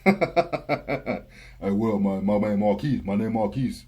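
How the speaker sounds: low, slurred country mumbling voice; deep country mumble. Acted. Imitating a customer giving his name as Marquise, deep country mumble. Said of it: "that deep country mumbling voice".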